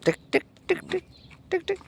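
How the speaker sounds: soft repeated tongue clicks; tongue clicks to call dog. Real, not acted. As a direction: click your tongue to get the dog's attention.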